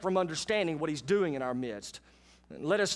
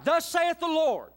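prophet Amos; 8 semitones higher, 4 dB louder than usual. Acted. Amos shouting God's warning to Israel, loud and high. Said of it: "Amos speaking".

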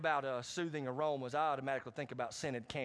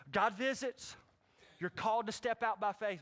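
preaching shout; 6 semitones higher than usual. Real, not acted. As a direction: lift the congregation with a raised cry.

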